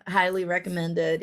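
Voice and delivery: ordinary talk, nothing marked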